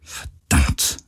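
low, whispered swearing under the breath; hushed, defeated curse; murmured curse. A narrator's reading. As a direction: murmur it to yourself, almost voiceless. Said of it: "murmelte sie immer wieder".

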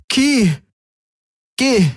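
high, raw cry telling him to go; driving him away in pain; anguished dismissal. A narrator's reading. Kirai sends Galen away, voice raw with hurt. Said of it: "gab sie zurück".

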